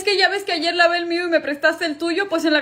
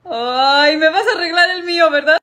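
whiny mock crying; 3 semitones higher, 6 dB louder than usual. [name] whines for his car.

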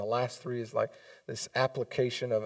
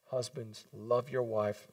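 usual speaking voice

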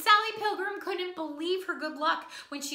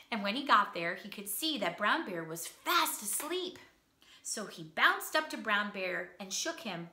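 usual speaking voice

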